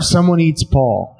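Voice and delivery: ordinary talk, nothing marked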